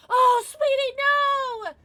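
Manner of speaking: high, loud wail of sympathy; dismayed sympathy. Real, not acted. Streamer hearing of a viewer's lost streak, dismayed wail. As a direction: wail it out for a friend's bad luck.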